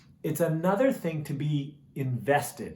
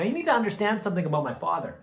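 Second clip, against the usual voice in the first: ordinary talk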